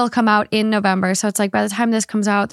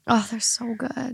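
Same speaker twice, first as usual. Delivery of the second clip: whispered; 8 dB quieter than usual. Real, not acted. Dreaming of grandma's cookies.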